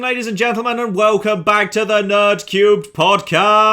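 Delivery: grand show-opening welcome; announcer voice. Acted. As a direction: boom it out like a host. Podcast intro announcer.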